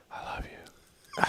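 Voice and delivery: slow, barely audible whisper; hushed, voiceless and drawn out. Shown as a demonstration. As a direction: whisper it softly right into an ear. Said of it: "like you actually whisper".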